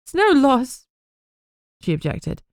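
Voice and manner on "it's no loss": much higher, trembling; grieving daughter, trembling and higher; quivering voice. Acted. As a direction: voice shaking with held tears. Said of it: "her voice quivering".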